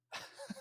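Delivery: a quiet chuckle, barely voiced; soft laugh. Real, not acted. Laughing at the memory while telling his co-host the scene. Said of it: "I'm laughing just thinking about this".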